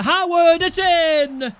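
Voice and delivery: a real cheer for the goal; goal celebration shout. Real, not acted. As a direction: shout it high and loud. Second goal scored.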